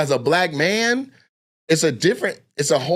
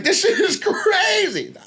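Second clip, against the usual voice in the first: amazed laugh; slower than usual. Retold or imitated. Amazed at freshmen dunking.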